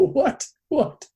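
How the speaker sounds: high, disbelieving double what; incredulous what. Retold or imitated. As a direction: retell your shock as if you can't believe it. Hearing the guru's guilt trip.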